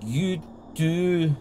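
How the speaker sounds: slow, halting reveal with long pauses; suspenseful game master narration. A narrator's reading. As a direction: draw out the discovery word by word.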